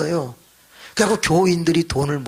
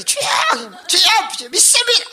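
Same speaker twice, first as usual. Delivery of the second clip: much higher, louder, climbing with each cry; frenzied crying out; 4 dB louder than usual. Retold or imitated. Frenzied worshippers crying to the Lord, rising shouts.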